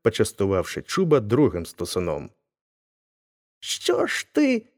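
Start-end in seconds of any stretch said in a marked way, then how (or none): 3.6-4.7 pain, anger and fear. A narrator's reading. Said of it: "таким голосом, у якому відчувалась і біль, і злість, і боязнь".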